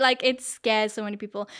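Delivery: ordinary talk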